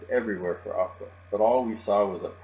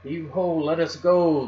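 loud, high work chant; raised pirate heave-ho; pirate yell; 8 semitones higher, 8 dB louder, slower than usual. A narrator's reading. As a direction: holler it like a crew hauling together. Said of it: "the pirates yelled".

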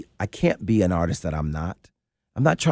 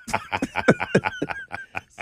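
high laugh. Real, not acted. Laughs at own joke.

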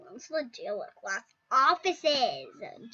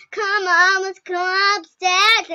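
louder, slow urging call; eager call; 5 semitones higher, 13 dB louder, slower than usual. Real, not acted. Kid loudly urging players upstairs. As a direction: call the others along.

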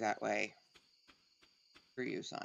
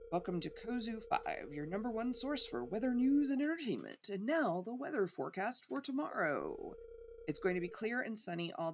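upbeat TV weatherman read; bright broadcast voice, reading it out; weather announcer; 7 semitones higher than usual. Pretend.

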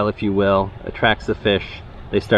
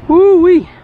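real joyful whoop, much higher and louder; 9 dB louder than usual. Real, not acted. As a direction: let out a big celebratory holler. Whoops at the caught fish.

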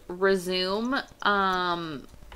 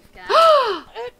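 loud high gasp of shock; big startled gasp; 12 dB louder than usual. Real, not acted. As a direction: gasp at the insult. Shocked at insult.

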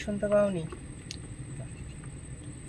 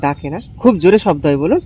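speaking loudly; 12 dB louder than usual. Real, not acted. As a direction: say it loud and clear. Talking loudly to a deaf old man. (জোরে জোরে বলা)

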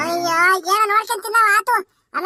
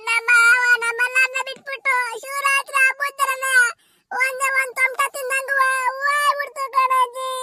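high, slow keening lament; acted wailing; 6 semitones higher, slower than usual. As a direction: wail out your grief, dragging each phrase. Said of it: "ಅಯ್ಯೋ ಅಳ್ಬೇಡ ಕಣ್ ಸುಮ್ನಿರವ".

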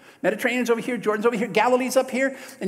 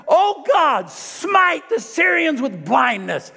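much louder, slow, higher, proclaimed; commanding prayer shout; 7 semitones higher, 8 dB louder, much slower than usual. Retold or imitated. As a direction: thunder the prayer at the enemy army. Prophet calls down blindness in prayer.

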